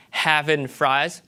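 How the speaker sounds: "having fries", slow, as havin' frahz; Southern accent. Shown as a demonstration. Lecturer contrasts accents, Southern "having fries". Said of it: "you hear that as a Southern accent".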